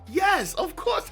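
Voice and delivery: ordinary delivery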